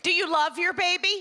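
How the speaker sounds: high, loud guilt-trip question; mock hospital pressure, loud and high; pushy nurse. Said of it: "This is how they ask you".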